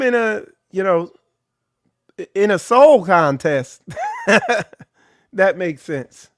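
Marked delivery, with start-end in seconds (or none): 3.9-4.6 a shrieking laugh; high burst of laughter. Real, not acted. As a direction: break into a high, startled laugh. Bursts out laughing after joke.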